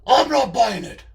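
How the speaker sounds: loud menacing character voice; Charlie's menacing voice. Acted. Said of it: "that voice does sound menacing".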